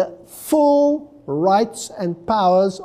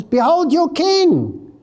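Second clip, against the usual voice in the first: Behold your king, king lifted high and slow; preacher stretching out "king" in "Behold your king!"; stress on king; 9 semitones higher, slower than usual. Shown as a demonstration. Preacher showing the congregation the stress on king. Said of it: "Can you see the emphasis on the king?".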